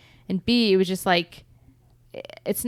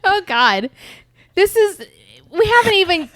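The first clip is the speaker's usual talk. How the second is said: high, loud laughing protest; 10 semitones higher, 5 dB louder than usual. Real, not acted. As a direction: laugh it out in disbelief. Laughing at friend's giggles.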